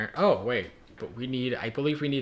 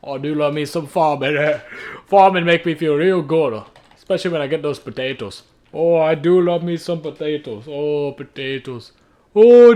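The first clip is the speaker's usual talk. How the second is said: hammed-up Irish farmer, building louder; carried away in a put-on Irish farmer accent; 3 semitones higher, 8 dB louder than usual. Farmer raving about his beloved potatoes, loud hammed-up Irish accent. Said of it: "like an Irish farmer".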